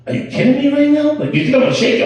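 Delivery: high, loud, fast disbelief; aghast disbelief. Retold or imitated. Father to son caught with phone, loud and high in disbelief. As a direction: recoil at the offered hand.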